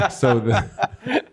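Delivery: real laugh breaking through; cracking up, high laugh. Real, not acted. Reading funny chat request.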